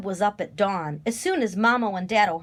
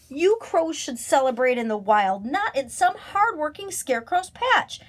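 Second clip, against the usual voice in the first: higher, indignant storybook scold; 6 semitones higher, 3 dB louder than usual. A narrator's reading. Scarecrow telling off the crows, high scolding. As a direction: tell them off, rising in pitch. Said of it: "Jeb scolded Lucy Crow".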